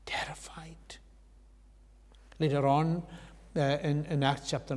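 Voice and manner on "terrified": whispered awe. A narrator's reading. Disciples falling down in fear.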